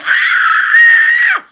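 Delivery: a huge, piercing, high scream of anger; full-throated scream. Shown as a demonstration. Showing how she screamed her anger at the ocean, full scream. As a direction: let it all out in one raw scream. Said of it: "Just like that".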